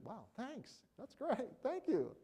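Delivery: lightly performed surprised gratitude; grateful exclamation. Shown as a demonstration. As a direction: sound genuinely thrilled to be thanked. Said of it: "you just say".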